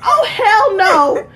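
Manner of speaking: high, loud, drawn-out refusal; slow, exaggerated indignant cry; mock outrage. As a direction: imitate an indignant friend, half laughing. Acts out friend's outraged refusal.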